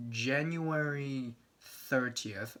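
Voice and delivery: ordinary delivery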